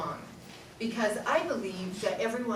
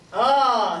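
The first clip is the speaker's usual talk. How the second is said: louder chesty oh; low register oh; 7 dB louder than usual. Shown as a demonstration. Teacher urging the class to use the low sound, chesty oh. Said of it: "you need this".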